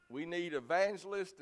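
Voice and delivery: ordinary talk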